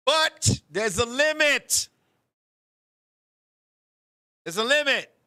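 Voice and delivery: slow, loud "But there's a limit"; emphatic. Real, not acted. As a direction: hammer the point home. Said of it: "stresses limit".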